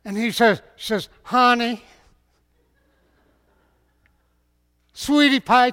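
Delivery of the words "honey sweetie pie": sweet-talking Delilah. Acted. Delilah sweet-talks Samson.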